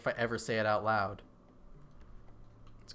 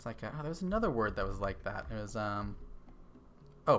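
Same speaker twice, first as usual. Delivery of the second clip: usual speaking voice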